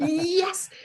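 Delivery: a sudden high, joyful cry of relief; excited yes. Retold or imitated. Hungry driver spotting Trader Joe's, an excited high yelp. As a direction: yelp it out like you just spotted the place you wanted.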